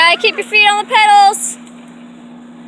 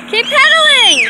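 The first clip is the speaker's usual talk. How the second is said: encouraging shout; 6 semitones higher, 4 dB louder than usual. Real, not acted. Cheering kid on bike.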